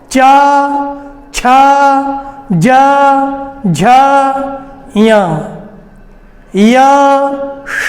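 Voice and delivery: च, छ, ज, झ, य, श each said alone, slowly and clearly; palatal sounds demonstrated. Shown as a demonstration. Teacher sounding out palatal letters for students. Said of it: "उच्चारण करके देख सकते हैं।".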